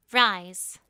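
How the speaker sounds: loud, sharp one-word command; a queen's loud command. Acted. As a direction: order them to their feet. Queen commands the bowing elite.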